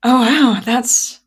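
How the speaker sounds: high, startled and laughing; surprised. Real, not acted. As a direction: caught off guard, voice jumps up. Caught off guard by question.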